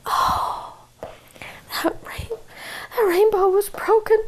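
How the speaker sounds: hushed whisper of dismay. A narrator's reading. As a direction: whisper the bad news. Sad whisper at breakage.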